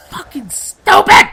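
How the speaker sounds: high, loud, drawn-out outburst; hammed-up furious yell; mock angry yell. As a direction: explode into a playful insulting shout. Mock yelling at girlfriend.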